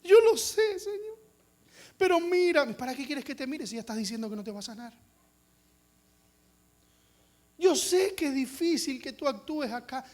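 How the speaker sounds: high, pleading, self-pitying whine; whiny hopeless prayer. Retold or imitated. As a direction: beg God while expecting no. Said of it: "nos arrodillamos y oramos y le decimos".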